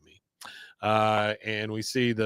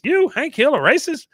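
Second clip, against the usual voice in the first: high, loud mock disbelief; imitated astonished protest; incredulous; 12 semitones higher, 6 dB louder than usual. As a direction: question it in amazed, rising tones. Voicing Roger's disbelief.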